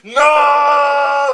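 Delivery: hammed-up scream of loss; anguished scream. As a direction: scream it out in grief. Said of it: "screamed Harry Potter".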